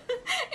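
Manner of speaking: squeaky little giggle; high mouse giggle. Acted. Mouse giggling at the owlet in a high squeak. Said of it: "The mouse giggled".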